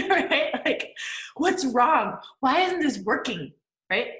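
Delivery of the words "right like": normal talking voice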